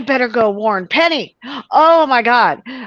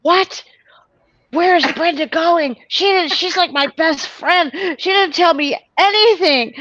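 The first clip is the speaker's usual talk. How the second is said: retold panic; 6 semitones higher than usual. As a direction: replay your own alarm at a friend's secret trip. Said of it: "so I'm like".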